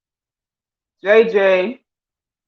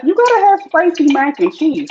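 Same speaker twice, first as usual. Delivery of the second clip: excited insistence; 10 semitones higher, 4 dB louder than usual. Real, not acted. Passionate about spice.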